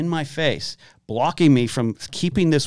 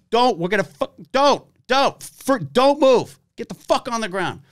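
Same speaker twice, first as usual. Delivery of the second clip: much higher, louder, dragged-out shouting; frantic cop yells; 11 semitones higher, 3 dB louder, slower than usual. Retold or imitated. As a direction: scream the orders at gunpoint. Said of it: "They're like".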